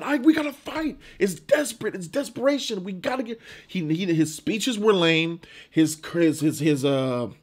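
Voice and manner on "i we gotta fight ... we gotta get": high, urgent rallying plea; imitating a fired-up speech, pitched high; mock passionate battle cry. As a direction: plead like a desperate rebel leader, voice up high. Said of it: "that passion that".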